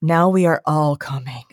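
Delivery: low, ominous group vow; mock menacing rage. Guest voicing every activated Slayer, low and menacing. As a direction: drop low, like an army rising. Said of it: "can no longer see straight from rage".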